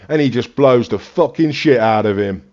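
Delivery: usual speaking voice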